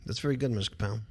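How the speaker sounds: normal talking voice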